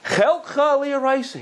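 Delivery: dramatic call. Acted. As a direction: call it out high and slow across the river. Sage calls out to bandit.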